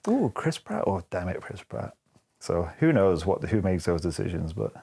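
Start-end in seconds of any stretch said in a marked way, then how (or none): 0.1-0.8 a higher, thrilled little ooh; light, imitated excitement at a star's name; mock delight. Nerds and parents seeing the poster, mock delight. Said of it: "they might be like". 0.9-1.9 softer, sinking mock groan; mock disappointment. Parents realizing who voices Mario, fading mock disappointment. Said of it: "they might be like".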